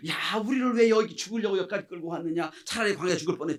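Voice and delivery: acted grumbling at the leader; resentful complaint. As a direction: complain bitterly as an aggrieved crowd. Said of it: "불평 원망하잖아요".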